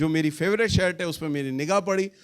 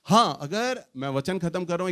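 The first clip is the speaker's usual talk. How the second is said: normal talking voice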